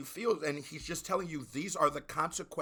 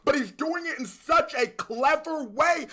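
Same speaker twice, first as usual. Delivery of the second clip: loud, high, thrilled yelling about the bar; hype shout; 12 semitones higher, 8 dB louder than usual. Real, not acted. Reacting to the song, shouting with excitement. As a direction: explode with excitement at the lyric.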